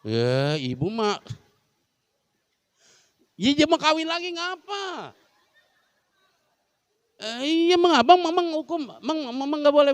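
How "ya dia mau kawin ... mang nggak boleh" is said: pleading wife. Pretend. Voices wife pleading with husband.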